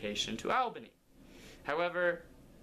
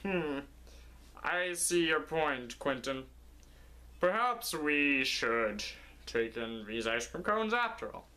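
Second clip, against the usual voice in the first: scheming fisherman. Acted. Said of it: "the other one said".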